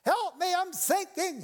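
high-pitched acted cry for rescue; desperate shout. Peter sinking in the water shouts to Jesus, desperate high cry. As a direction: cry out as if going under. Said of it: "shouts out to Jesus".

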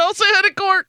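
put-on high-pitched, giddy kid voice; excited little kid. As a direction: imitate an overexcited child. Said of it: "super excited like a little fucking kid".

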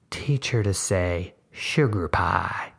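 put-on slow, sly, suggestive old woman. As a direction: drawl it out with a toothless grin. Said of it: "smile was broad and toothless and suggestive".

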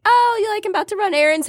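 other partner. Acted.

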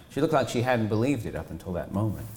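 ordinary delivery, nothing marked

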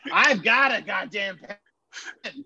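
high, slow, drawn-out outburst; Bob Knight tirade. Acted. Coach fuming at his helper about the pen, exasperated. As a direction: stretch out each word in mock fury. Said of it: "Classic Bobby Knight".

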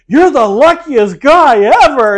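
imitated loud gushing, high and drawn out; hammed-up high, loud exclamation; really loud. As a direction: belt it out slowly like an over-excited admirer. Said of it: "saying it really loud".

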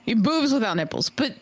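ordinary delivery

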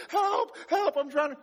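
high, loud panicky yelling for help; panicked cry for help. Retold or imitated. Pastor reenacting his canoe panic, high panicked cries. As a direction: flail and shout for help like you're drowning. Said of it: "I started panicking so hard".

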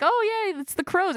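high-pitched mock delight; family seeing the crows. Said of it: "they're like".

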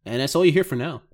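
ordinary talk, nothing marked

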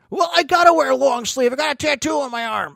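high-pitched mock protest; whiny excuse. Her excuse for long sleeves, mock whining.

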